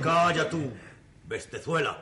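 angry rebuke. Acted. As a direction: shut him up hard. Master silencing servant.

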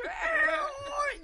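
high laughing dragon roar shout. Retold or imitated. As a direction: roar like the dragon while cracking up. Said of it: "empiezo a hacer el dragón".

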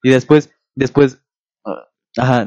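normal talking voice

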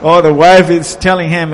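ordinary delivery

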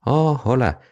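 high-pitched, offhand greeting; feigned casualness. A narrator's reading. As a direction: greet as if it were nothing. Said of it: "como quien no quiere la cosa".

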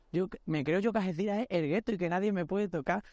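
plain reading voice

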